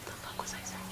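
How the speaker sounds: muttered under her breath; whispered aside. Real, not acted. Vlogger forgets her point and whispers to herself. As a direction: whisper it to yourself, lost. Loses her train of thought.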